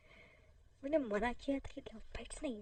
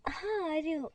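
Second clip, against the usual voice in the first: calling out; 3 semitones higher, 6 dB louder than usual. Acted.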